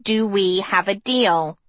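goading. Acted. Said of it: "he goaded her".

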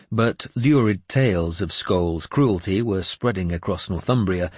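ordinary delivery

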